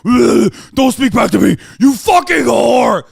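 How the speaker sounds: imitated macho tantrum; mock furious yell. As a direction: shout it like a raging host. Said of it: "they go fucking insane".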